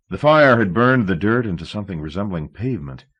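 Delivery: ordinary delivery, nothing marked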